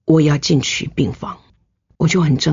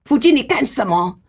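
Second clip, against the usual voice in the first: angry father-in-law; 6 semitones higher than usual. Retold or imitated. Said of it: "他就很生气".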